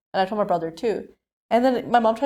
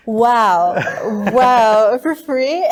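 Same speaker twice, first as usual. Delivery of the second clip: louder, sarcastic wow with a laugh breaking in; mock amazement; 7 dB louder, much slower than usual. Narrator mocking the scammer's free breakfast, laughing sarcasm. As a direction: fake being dazzled by the offer.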